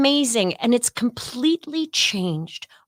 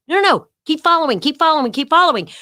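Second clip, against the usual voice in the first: hurried staffer; 7 semitones higher, 4 dB louder, faster than usual. Retold or imitated. Staffer hurrying them along.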